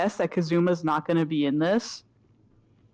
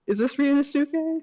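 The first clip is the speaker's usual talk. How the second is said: much higher, puzzled character voice; high questioning voice; 8 semitones higher than usual. Acted. Player trying out who is talking, high puzzled voice. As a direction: pitch the question right up.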